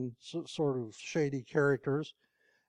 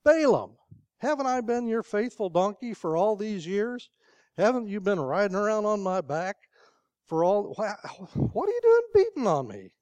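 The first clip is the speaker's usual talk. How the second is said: talking donkey; 6 semitones higher, 4 dB louder than usual. Acted. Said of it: "The donkey says".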